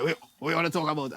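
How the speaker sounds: put-on low, soft and quick, like an old man; a hint of a mumbling old man. Teen cousin talking like an old man, low and soft. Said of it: "talking like an old man".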